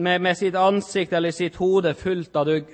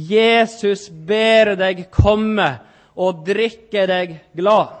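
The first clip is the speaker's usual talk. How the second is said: slow and louder, leaning on each word; emphatic slow appeal; 4 dB louder, much slower than usual. Real, not acted. Preacher urging the listener to come and drink, slow and loud. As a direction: plead with them to come.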